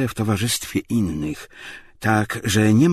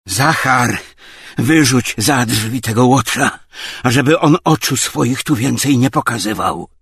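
loud, raised-pitch order; angry command; 6 semitones higher, 8 dB louder than usual. A narrator's reading. Obłomow orders Zachar to throw him out, angry command. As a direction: call the servant and command sharply.

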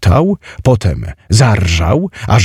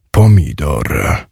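low, slow giant voice; groaning giant; 5 semitones lower, slower than usual. A narrator's reading. Giant answers the sum with the game word, groaning. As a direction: drag „Pomidor” out low and stolid. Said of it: "stęknął gigantyczny strażnik skarbu".

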